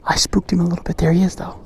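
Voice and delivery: soft half-whisper; hushed murmur. Real, not acted. Vlogger watching the animal he spooked, hushed. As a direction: keep it down near the animal.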